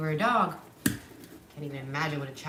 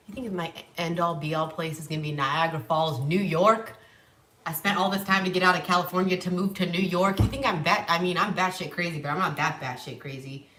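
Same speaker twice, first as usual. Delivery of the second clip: indignant rant; 9 dB louder than usual. Real, not acted. Ranting to viewers about Niagara Falls, loud exasperation.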